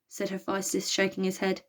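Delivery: ordinary delivery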